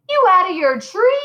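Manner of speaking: high, loud alarmed yelp. A narrator's reading. Stan to Harry after he says the name, a high loud yelp. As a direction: blurt it out in sudden alarm. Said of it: "yelped Stan".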